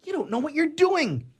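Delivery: high, loud desperate cry; frantic shout. Acted. Man shouts at the angel to stop her burning the wings, frantic shout. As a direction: yell to stop her in time.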